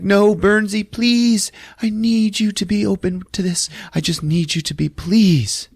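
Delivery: high-pitched mock begging; desperate pleading. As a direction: plead urgently, imitating a desperate wife. Wife begging husband to join seance.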